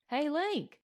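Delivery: hearty hail, held back in volume; booming call. A narrator's reading. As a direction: call out to a pal at a distance. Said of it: "booming over the links".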